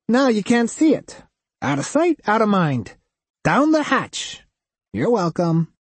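high, nasal waitress voice; weary waitress, pleased with herself; flat nasal waitress. A narrator's reading.

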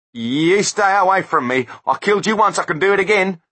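high and loud, panicked bluster; frightened threat. A narrator's reading. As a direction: warn him off in panic. Terrified threat to ghost.

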